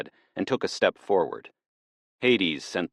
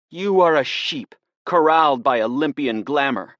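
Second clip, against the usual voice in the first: higher, louder, an accusing bitter rant; bitter tirade; 10 semitones higher, 7 dB louder than usual. Acted. Woman hurt by a god lashing out at Persephone, bitter and loud. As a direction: hurl every word like a charge against her.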